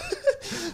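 sudden high-pitched giggle; caught-off-guard high laugh; high squealing laugh. Real, not acted. Teased with pumpkin nickname.

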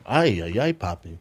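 low, soft broken-English come-on; mock maid accent. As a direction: purr it like the maid, lightly accented. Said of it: "Her English is terrible".

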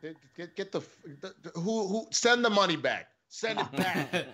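rising outrage, pitch up; outraged. Real, not acted. Outraged at donation.

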